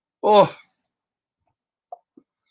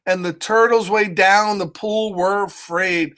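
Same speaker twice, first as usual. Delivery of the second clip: ordinary delivery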